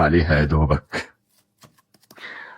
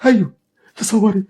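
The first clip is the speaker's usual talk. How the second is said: high, raised accusing voice; bitter anger; 11 semitones higher than usual. Acted. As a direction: lash out at her cruelty. Father's angry accusation.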